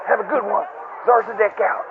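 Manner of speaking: ordinary delivery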